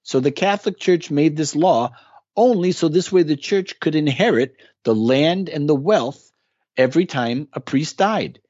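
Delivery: ordinary delivery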